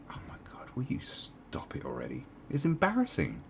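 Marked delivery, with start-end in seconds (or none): 0.1-2.3 whispered, fed up; exasperated whisper. Retold or imitated. Fed-up viewer whispering at people to stop. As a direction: hiss it under your breath, embarrassed. Exasperated aside about the reaction.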